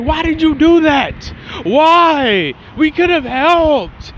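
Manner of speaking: mock-furious, high-pitched outburst; fuming. Gohan fuming at why they weren't helped, high angry outburst. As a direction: cry out in acted anger, voice shooting up.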